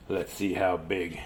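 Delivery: low, slow, soft brave elephant; heroic Gerald. Acted. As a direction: promise it slowly and low. Gerald heading off.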